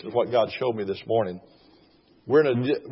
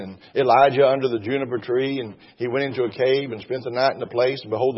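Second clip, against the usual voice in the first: ordinary delivery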